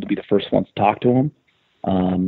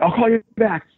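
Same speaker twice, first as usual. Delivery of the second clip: high, hurried hang-up line; rushed excited; 11 semitones higher than usual. Retold or imitated. Telling Brez he has to go, excited. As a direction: cut the call short, buzzing with news. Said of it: "I'm like".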